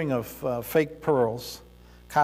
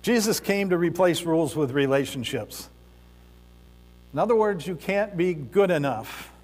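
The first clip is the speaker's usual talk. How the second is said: raised, emphatic preaching voice. Acted. Preacher pressing the congregation on the rich ruler's choice, emphatic. Preacher drives home the point.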